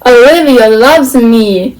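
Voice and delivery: slow, savoured declaration, mildly delighted; burbling delight. Acted. Malvolio clutches the love letter to his bosom, mildly burbling. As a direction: burble it, drawing the words out. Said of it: "he burbled".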